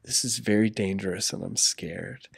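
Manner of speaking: soft, overly polite pleading; mock polite plea. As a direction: sweetly ask him back from the cliff edge, mock-calm. Mock polite plea to daredevil son.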